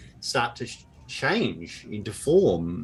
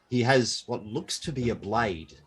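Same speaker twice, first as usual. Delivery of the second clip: ordinary delivery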